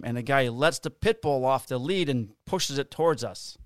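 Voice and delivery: usual speaking voice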